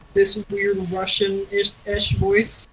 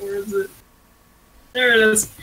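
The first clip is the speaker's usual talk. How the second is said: ordinary talk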